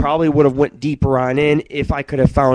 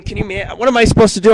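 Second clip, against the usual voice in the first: exasperated; 7 semitones higher, 4 dB louder, faster than usual. Real, not acted. Ranting exasperated question.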